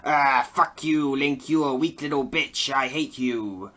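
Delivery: slow sneering bird-warrior put-on; mocking Revali. Reviewer mocking Revali insulting Link, sneering put-on. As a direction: sneer it out like a snob. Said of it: "Revali's like".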